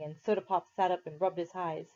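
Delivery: usual speaking voice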